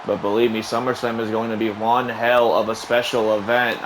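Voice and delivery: ordinary delivery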